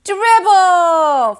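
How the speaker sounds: put-on loud high toddler holler. A narrator's reading. Fudge hollering for the pet, loud high shout. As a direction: shout the name like a three-year-old. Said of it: "Fudge hollered".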